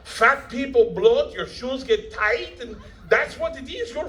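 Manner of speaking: high, slow, laughing mock of a blunt costumer; mocking costume staff. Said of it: "And they're like".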